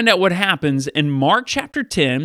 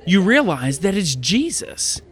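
ordinary delivery

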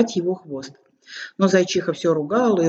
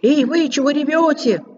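shouting; 9 semitones higher than usual. Acted. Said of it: "заорал, что было мочи". (испуганный крик)

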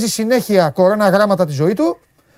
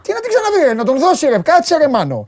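higher-pitched, indignant objection; heated protest; 7 semitones higher than usual. Real, not acted. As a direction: push back in a raised pitch, exasperated. Heated argument over abused woman. (έντονη διαμαρτυρία με ψηλή φωνή)

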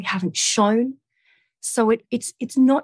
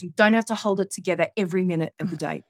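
ordinary delivery

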